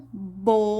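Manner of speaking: "boca" split into bo, pause, ca; syllable by syllable. Shown as a demonstration. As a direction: read "boca" one syllable at a time. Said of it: "Vocês vão ler uma de cada vez".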